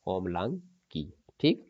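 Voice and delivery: ordinary talk, nothing marked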